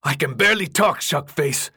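high, loud, exasperated yell; irritated shout from a worn-out runner; raw-voiced yell. A narrator's reading. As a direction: yell back through a raw throat. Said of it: "Minho yelled, his voice raw".

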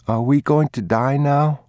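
put-on a small boy fretting aloud; boy's voice. A narrator's reading. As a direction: ask it as an anxious child.

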